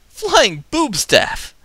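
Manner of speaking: high, slow loud announcement; excited. Acted. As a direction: proclaim it with glee.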